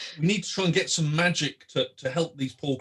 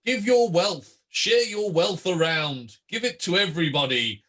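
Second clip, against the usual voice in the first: raised, hearty Santa-style proclamation; Fungo playing Santa; 4 semitones higher than usual. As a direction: call it out like a street-corner Santa. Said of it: "interrupt sort of Fungo, who is".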